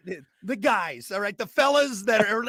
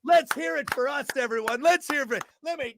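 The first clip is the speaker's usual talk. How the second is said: higher, louder crowd hype; hype man; 7 semitones higher, 4 dB louder than usual. Acted. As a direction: rev up an imaginary crowd. Hyping up a crowd.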